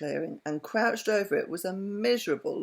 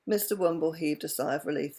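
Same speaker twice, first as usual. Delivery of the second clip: usual speaking voice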